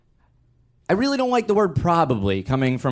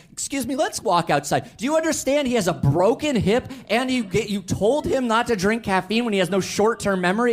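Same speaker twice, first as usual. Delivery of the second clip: higher, pressing disbelief at the doctor; exasperated confrontation; 11 semitones higher than usual. Retold or imitated. Son confronting the heart doctor in the hallway, exasperated. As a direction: lay into him, incredulous.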